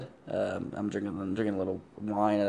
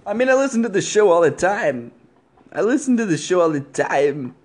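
pitched up, louder put-on caller voice; changed caller voice; 7 semitones higher, 6 dB louder than usual. Prank caller [name] answering the podcast hosts in a louder put-on voice. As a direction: slip into the caller character's louder, higher voice. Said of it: "did your voice just change up there".

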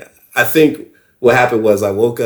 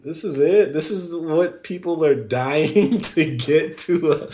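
higher-pitched, drawn-out disbelief; retold incredulity; 6 semitones higher, slower than usual. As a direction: question it in amused, high-voiced bafflement. Retold disbelief at the drug's effect.

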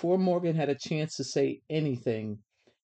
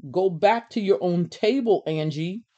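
raised, louder fed-up order; 5 semitones higher, 6 dB louder than usual. A narrator's reading. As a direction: send them away, out of patience. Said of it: "Sam had had enough".

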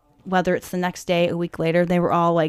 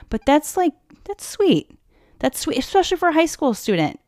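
touched; 8 semitones higher than usual. Real, not acted. Touched by kind guest.

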